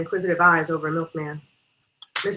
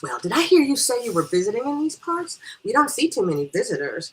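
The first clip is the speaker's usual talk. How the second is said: raised, sing-out chatty questioning; nosy sociable guest; 6 semitones higher, 5 dB louder than usual. Acted. Guest quizzing a visiting stranger, nosy and chatty. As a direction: make eager small talk, pitched up.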